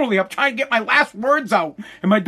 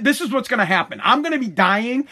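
normal talking voice

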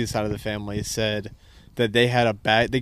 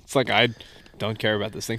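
ordinary talk

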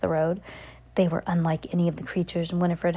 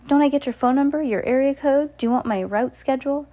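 higher, louder flirty bus driver; 8 semitones higher, 4 dB louder than usual. A narrator's reading. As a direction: chat her up eagerly. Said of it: "the driver said".

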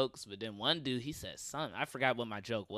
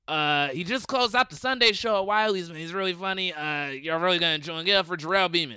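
club host intro; 6 semitones higher, 6 dB louder than usual. Retold or imitated. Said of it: "host is like".

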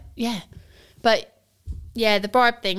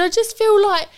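put-on upset child; 9 semitones higher, 3 dB louder than usual. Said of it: "crying his eyes out".

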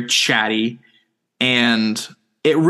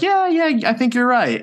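much higher, lightly put-on agreeing voice; retold conceding bartender; 11 semitones higher than usual. Bartender concedes to the guest who corrected him, mock agreeable. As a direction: imitate someone giving in, pitched way up.

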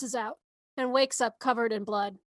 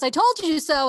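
louder, higher told-you-so shout; 5 semitones higher, 8 dB louder than usual. Retold or imitated. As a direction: yell it in triumph. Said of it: "more or less shouts".

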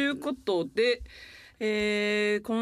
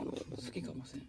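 whispered try-out; 9 semitones lower, 15 dB quieter than usual. Shown as a demonstration. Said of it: "trying the dialect phrase aloud".

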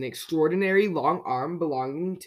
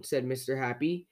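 plain reading voice

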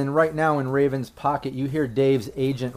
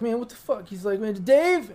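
put-on rattled drunk dude; 8 semitones higher than usual. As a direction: lightly play a cowed drunk, pitched up. GM voices drunk dude cowering.

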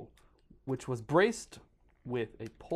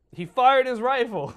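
loud, high and slow for the big moment; story peak, much louder and higher; dramatic narration; 10 semitones higher, 11 dB louder than usual. Acted. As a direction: land the shot with a punch.

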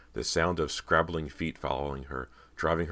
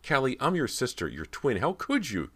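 higher, terrified pleading; higher shriek to her twin; frightened shriek; 10 semitones higher than usual. A narrator's reading. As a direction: beg in panic. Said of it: "Cody shrieked, so frightened".

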